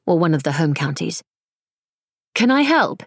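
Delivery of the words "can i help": offering help. A narrator's reading.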